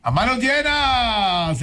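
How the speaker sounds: high, slow, stretched-out call; drawn-out exclamation. Real, not acted. Host breaking into the studio chat, drawn-out exclamation. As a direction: call it out, dragging the vowels.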